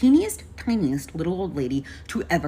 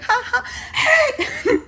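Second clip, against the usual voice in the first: very high, drawn-out ha-ha into a yell; forced high laugh, loud; acted laugh; 5 dB louder, much slower than usual. Helper laughing along while fighting a driver, high and loud.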